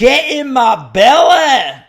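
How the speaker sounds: loud, high Fat Bastard Scottish roar; Scottish accent. Retold or imitated. Quoting the Axe Murderer movie, booming Scottish accent. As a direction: bellow it in a thick Scottish accent.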